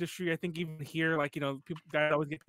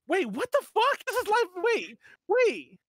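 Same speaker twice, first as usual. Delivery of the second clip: high startled outburst; surprised, voice jumping up; shocked; 12 semitones higher, 4 dB louder, slower than usual. Real, not acted. Shocked at chat message.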